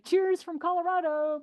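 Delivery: high, bright cheers from Colorado; chirpy sign-off from Colorado; cheery sign-off. Retold or imitated. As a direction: sing out your greeting. Her catchphrase sign-off.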